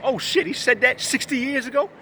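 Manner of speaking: high, rising shocked question; shocked disbelief. Retold or imitated. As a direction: act like you just found out. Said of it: "You'd be shocked, like".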